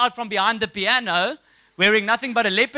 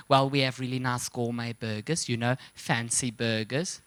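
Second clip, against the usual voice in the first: soft, lower, patronising waitress voice; condescending waitress; 9 semitones lower, 7 dB quieter than usual. Retold or imitated. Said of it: "She goes".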